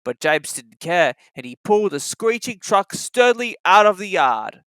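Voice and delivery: ordinary delivery, nothing marked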